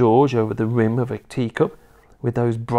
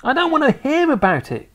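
put-on high, upset little boy; cried protest; 4 dB louder than usual. A narrator's reading. George begging grandma to stop, upset. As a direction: cry out in a high child voice. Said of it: "cried George".

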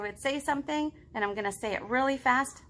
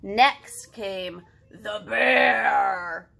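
booming announcement; 8 dB louder, much slower than usual. A narrator's reading. Dramatic bear announcement.

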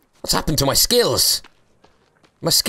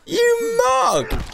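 squeaky high jeer at the fallen mob; shrill laughing jeer; high mocking taunt. Real, not acted. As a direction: taunt him in a high cartoon voice. Mocking again, higher.

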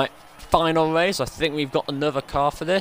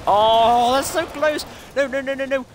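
excited dismay; 9 semitones higher, 5 dB louder than usual. Real, not acted. Nearly catching the rival car.

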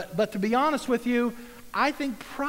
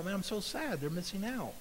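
much softer, a touch of grief; mild sorrow; 9 dB quieter than usual. Pretend. A believer grieving for people missing out, softly sad. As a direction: sigh it with gentle sadness. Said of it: "why don't I have God's heart and go".

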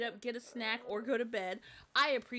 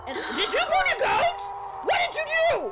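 alarmed shout; 11 dB louder than usual. Real, not acted. Monster suddenly chasing them.

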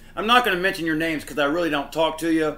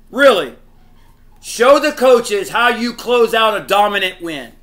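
irritated; 3 semitones higher, 6 dB louder than usual. Real, not acted. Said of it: "but I am so irritated".

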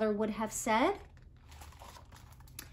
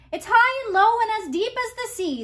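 higher, louder, fast fish voice; a lightly voiced giant golden fish, louder and quick; booming fish voice; 10 semitones higher, 9 dB louder, faster than usual. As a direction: boom it out like a big magical fish. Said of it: "in a voice that made Finn's boat shake".

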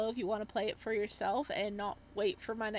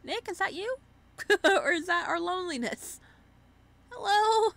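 playful calling out; 8 semitones higher, 6 dB louder than usual. Real, not acted. Calling out to character Nick.

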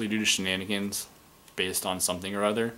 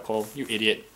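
ordinary talk

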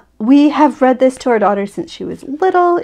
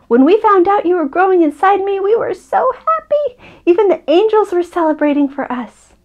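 higher, delighted mother's voice; happy storybook mama; 9 semitones higher than usual. Acted. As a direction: share joyful news with a small child.

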